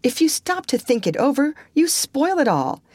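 put-on high, excited girlish voice; high-pitched, swept-up objection; eager protest. A narrator's reading. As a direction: argue the point with rising enthusiasm. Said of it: "protested Anne".

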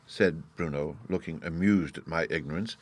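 usual speaking voice

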